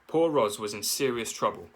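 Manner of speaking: usual speaking voice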